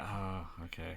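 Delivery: soft, reluctant mock acceptance; quietly resigned imitation. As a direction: give in grudgingly, under your voice. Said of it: "sounds reluctant".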